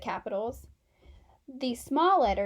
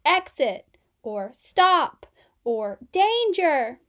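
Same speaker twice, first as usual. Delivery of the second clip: louder on each warning word; 3 semitones higher, 7 dB louder, slower than usual. Acted. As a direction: call them out like signs. Warning words shouted out.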